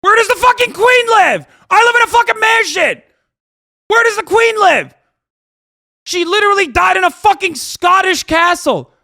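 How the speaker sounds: high, loud incredulous yelling; furious shout. Real, not acted. Streamer to viewer criticizing his mansion, furious yelling. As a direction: hammer the retort home at full pitch.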